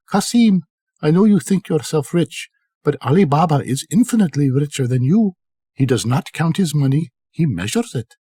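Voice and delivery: Kasim's wife high and quick, spiteful; a lightly played envious wife goading her husband; jealous wife. Kasim's wife telling Kasim Ali Baba is richer, spiteful and quick.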